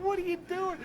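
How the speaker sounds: high-pitched imitation of a startled movie character; mock alarmed protest. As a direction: squeal it in comic outrage. Acting out a film character's protest.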